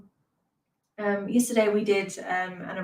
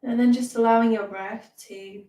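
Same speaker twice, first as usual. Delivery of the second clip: measured meditation voice with long pauses; guided meditation. Real, not acted. Teacher leads beginners through mindfulness and breathing, guided meditation.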